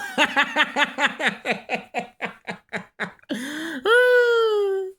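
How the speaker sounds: high staccato cackle ending in a squeal; long high laugh, breaking into a loud squeal; big cackling laugh. Real, not acted. Laughing at the comeback he retells.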